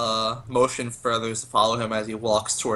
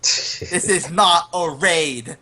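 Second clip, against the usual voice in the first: drawn-out, higher-pitched action-hero yell; mock yell; 3 semitones higher, much slower than usual. Player yelling as the character bursting through the door, mock yell. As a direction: yell it slowly, stretching the last words. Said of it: "and I just yell".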